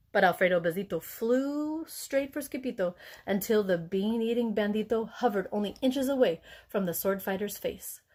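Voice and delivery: ordinary delivery, nothing marked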